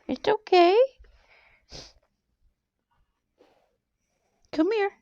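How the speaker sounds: high, sing-song comfort for a pet; high cooing pet voice; high soothing pet talk. Real, not acted. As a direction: coo it gently to the dog.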